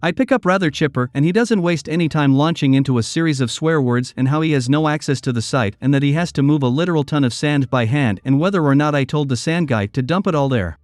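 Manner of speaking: usual speaking voice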